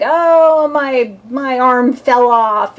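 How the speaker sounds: loud, drawn-out fake wail; badly faked crying, starting high and loud; mock sobbing. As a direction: wail it like a hammed-up victim. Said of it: "like, sobbing and crying and like".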